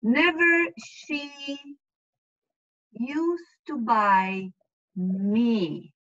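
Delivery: slow, word by word, like a gloss; slow literal translation. Shown as a demonstration. Teacher to student, slow word-by-word translation. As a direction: spell out each word slowly. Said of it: "I'm gonna literally translate".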